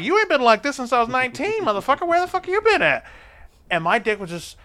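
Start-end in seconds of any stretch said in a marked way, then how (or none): 0.0-3.0 high, loud delighted disbelief; mock-outraged happy reunion; excited high rant. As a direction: greet an old friend back with glee. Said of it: "I'm like".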